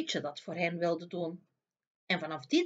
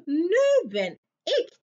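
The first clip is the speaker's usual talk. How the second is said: slow, louder, gloating villain; gloating queen; 9 semitones higher, 6 dB louder, much slower than usual. A narrator's reading. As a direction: savour every word of victory. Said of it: "Lachte de koningin".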